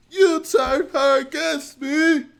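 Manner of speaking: high, loud, strained cry; anguished accusation. Pretend. Streamer confronting Lucas, high anguished cry. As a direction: hurl the accusation in betrayed anguish. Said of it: "And I'll be like".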